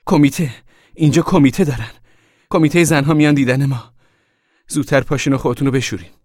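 high, loud, urgent announcement. A narrator's reading. Mother warns the family the women's committee is coming, loudly. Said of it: "مادر با صدای بلند گفت".

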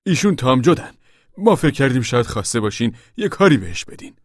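high, loud worker's voice; Timothy, eager petitioner. A narrator's reading. Voices Timothy asking for work.

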